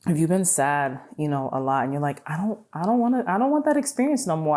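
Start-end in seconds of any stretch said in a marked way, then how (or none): 2.3-4.6 firm refusal. Retold or imitated.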